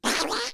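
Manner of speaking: very high, soft quacking noise; duck-like squawk. Shown as a demonstration. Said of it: "Sound like a duck".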